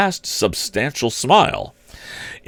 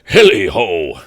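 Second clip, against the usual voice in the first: a hearty hilly-ho, louder; jolly cry; 5 dB louder, slower than usual. A narrator's reading. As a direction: whoop it out like a merry old boss. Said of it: "cried old Fezziwig".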